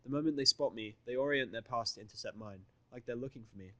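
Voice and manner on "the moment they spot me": ordinary delivery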